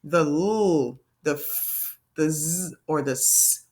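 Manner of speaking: "l", "f", "z", "s" each drawn out; letter sounds. Shown as a demonstration. Teacher listing doubling-rule endings, sounds held out. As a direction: hold "l", "f", "z", "s" as pure sounds, not letter names. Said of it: "that sound at the end".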